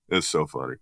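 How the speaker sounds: ordinary talk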